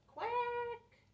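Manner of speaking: a very high squeaky quack; duck quack. Acted. Little duck calls to the truck with a high quack. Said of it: "Little duck called".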